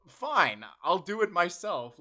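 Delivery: loud, huffy mock decision; mock fed-up resolve. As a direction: announce it like a hammed-up "fine, then". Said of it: "And I was like".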